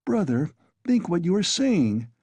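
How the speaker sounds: high, hasty protest; impetuous. A narrator's reading. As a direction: rush in before thinking. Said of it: "began impetuously".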